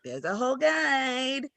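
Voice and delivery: high, slow, drawn-out answer; teasing singsong announcement; playful reveal. Acted. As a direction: draw it out like unveiling a secret. Answers imagined parent with reveal.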